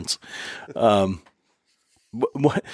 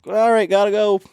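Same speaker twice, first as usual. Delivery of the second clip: hurried brush-off; 10 semitones higher, 3 dB louder than usual. Retold or imitated.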